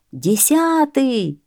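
light, high crowd cry; crowd's cry. A narrator's reading. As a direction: echo the count high and stretched. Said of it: "кричит народ".

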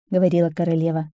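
plain reading voice

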